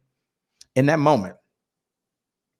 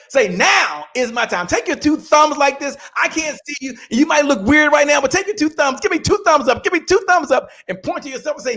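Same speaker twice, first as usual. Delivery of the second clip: preaching at full force; 8 semitones higher than usual. Acted. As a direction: drive the crowd to chant with you. Leads audience to repeat mantra.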